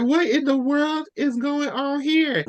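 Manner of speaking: high, drawn-out mock astonishment; astonished disbelief. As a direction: play up bewildered disbelief, slowly and high. Acted astonished reaction.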